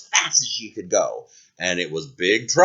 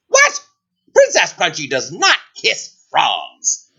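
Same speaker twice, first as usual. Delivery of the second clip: indignant princess; 3 dB louder, slower than usual. Acted. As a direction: refuse grandly. Princess refuses.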